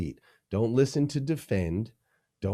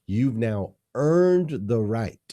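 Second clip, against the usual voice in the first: earned stretched slowly and pushed louder; stress on earned; 3 semitones higher, 5 dB louder, slower than usual. Shown as a demonstration. Leadership guest stressing that advice must be earned, slow and pressing. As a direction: lean hard into the word earned. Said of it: "I stress that word earned".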